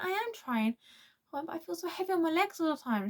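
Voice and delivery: pitched well up, pleading she is trying; insist, high and upset, legs heavy; pleading protest. Retold or imitated. Girl pleads with mum that she is trying, high upset protest.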